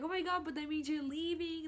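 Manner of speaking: high, plaintive realisation; retold high, teary voice; imitating emotional sister. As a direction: act out happy news turning bittersweet. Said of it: "she was getting emotional".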